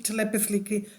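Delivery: laughing softly through the corrected word; a quiet chuckle while fixing the word "telepathically". Real, not acted. Speaker laughs at her own slip, softly laughing.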